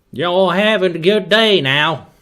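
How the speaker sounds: big put-on Texan accent; attempted Texan accent. Shown as a demonstration. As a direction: greet folks in a hammed-up Texan accent. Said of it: "just my attempt of a Texan accent".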